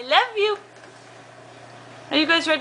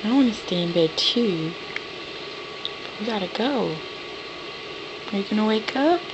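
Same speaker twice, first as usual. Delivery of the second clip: soft wake-up call; 2 semitones lower, 7 dB quieter than usual. Real, not acted. Parent waking child for church.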